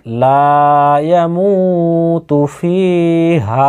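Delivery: slow recitation, লা, মু, ফি and হা held long; drawn-out madd. Shown as a demonstration. Teacher quizzing learners on madd, slow drawn-out recitation. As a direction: stretch লা, the মু of ইয়ামুতু and both vowels of ফিহা. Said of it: "কিসে কিসে টানলাম বলেন তো?".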